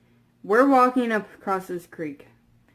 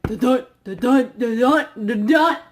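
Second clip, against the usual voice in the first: imitated heartbeat. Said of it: "our hearts are like".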